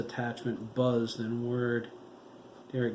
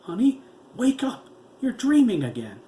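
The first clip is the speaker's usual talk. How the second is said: urgent shout; 11 semitones higher, 10 dB louder than usual. A narrator's reading. Wife waking husband from nightmare.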